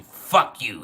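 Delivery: loud, explosive imitation of an angry father; angry cussing-out. Father cussing out his child over God, imitated in a loud angry burst. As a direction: burst out cursing as the enraged father. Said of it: "He started cussing me out like he has a demon in him".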